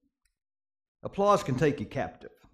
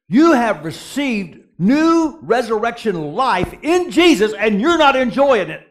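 fervent challenge; 6 semitones higher, 7 dB louder than usual. Acted. Preaching challenge to church.

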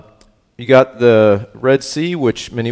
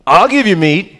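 high, loud, booming voice of God; God's voice; 10 semitones higher, 6 dB louder, slower than usual. Retold or imitated. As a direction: thunder it out like an exasperated God. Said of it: "He says".

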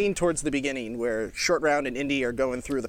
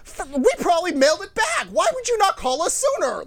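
much higher, shrill-pitched mock scream of a furious clerk; screaming postal clerk; 5 dB louder than usual. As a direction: act out the clerk yelling in disbelief, pitched way up. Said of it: "he's screaming at me".

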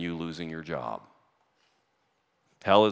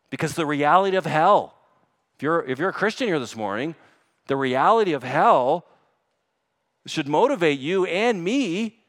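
loud, raised, driving exhortation; forceful preaching; 7 semitones higher, 7 dB louder than usual. Real, not acted. Preacher urging Christians to live holy lives, forceful. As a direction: press the point on the congregation.

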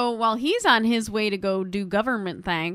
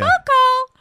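high sing-out call; imitated wife hollering to husband; 14 semitones higher, 8 dB louder than usual. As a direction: call it through a door, high and loud. Wife calling through the door.

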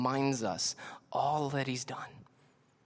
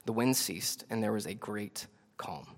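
ordinary delivery

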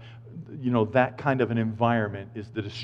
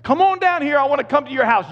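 much higher, louder call up to a man in a tree; 13 semitones higher, 6 dB louder than usual. Retold or imitated. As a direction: shout up warmly to him. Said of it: "Jesus is at the bottom of that tree going".

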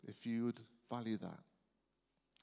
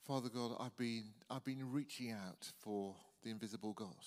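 soft, unhurried prayer with long pauses; quiet prayer; 2 semitones lower, 5 dB quieter than usual. Acted. Preacher leading the congregation in prayer, quiet and gentle. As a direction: pray gently, letting each phrase settle.

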